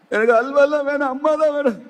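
high, sustained pretend wail; mock crying child. Boy refuses halwa and wants mother, high mock crying. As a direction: whine the refusal as a tearful little boy. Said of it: "இவனுக்கு அழுக நிக்கல".

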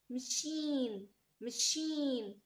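"مشين" very slow, with a drawn-out sh; sh sound. Shown as a demonstration. Teacher modelling the sh sound, "مشين" slow and drawn out. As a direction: stretch "مشين" slowly, ch said as sh. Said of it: "ش ساوند".

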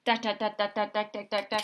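hammered, steady da da da; jackhammer noise. A narrator's reading. Imitating a jackhammer in the street for a child. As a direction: bang out each da like a drill. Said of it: "Jackhammer hurts my ears".

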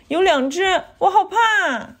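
put-on high, whining child's fear; acted high-pitched fright; frightened child. A narrator's reading. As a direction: tell it like a scared little one. Scared child tells mother of wolves.

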